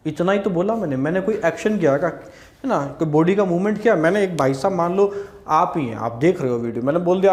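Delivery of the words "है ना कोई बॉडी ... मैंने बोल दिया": ordinary talk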